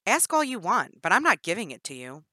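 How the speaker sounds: loud, quick defiant snap; defiant retort. Acted. Jennifer defying Danny, loud and quick. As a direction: throw it back at her. Prisoner defies loudly.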